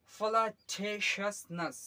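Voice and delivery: flirtatiousness stretched out very slowly, a bit high; very slow model word. Shown as a demonstration. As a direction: drag flirtatiousness out syllable by syllable. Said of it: "slow revision model". (flirtatiousness बहुत धीरे, खींचकर)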